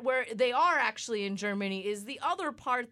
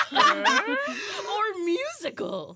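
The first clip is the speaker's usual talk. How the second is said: slow words through laughter; laughing; 11 semitones higher, 7 dB louder, much slower than usual. Real, not acted. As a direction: drag the word out while laughing.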